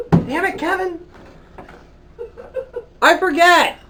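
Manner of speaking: loud, high exasperated outburst; frustrated shout. Real, not acted. Host yelling at co-host after forgetting his question, exasperated. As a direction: blow up at yourself for forgetting.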